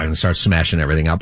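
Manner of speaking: normal talking voice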